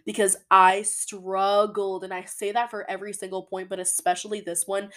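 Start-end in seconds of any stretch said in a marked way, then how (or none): 0.0-2.0 "struggled" drawn out, slow and louder; stress on struggled. Shown as a demonstration. Host admitting her struggle to listeners, slow stress on "struggled". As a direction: lean hard on "struggled", stretch it out. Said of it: "emphasis on the word struggle".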